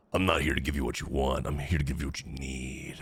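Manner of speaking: low, solemn movie-hero line; deep dramatic voice. Retold or imitated. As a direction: deliver it deep and ominous. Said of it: "Why are you saying it like this".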